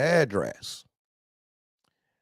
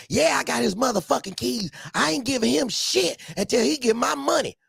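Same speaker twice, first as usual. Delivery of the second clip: mock angry woman; 10 semitones higher than usual. Woman refusing keys.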